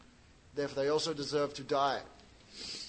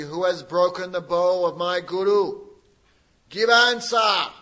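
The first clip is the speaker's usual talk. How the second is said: very angry shout; 3 semitones higher, 11 dB louder, slower than usual. Acted. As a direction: roar the demand at full force. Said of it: "very angry".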